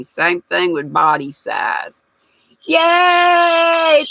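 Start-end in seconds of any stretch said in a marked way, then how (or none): 2.7-4.0 high, loud cheer of delight; a quick burst of joy; excited cheer. Real, not acted. Thanking a viewer in chat with a loud happy cheer.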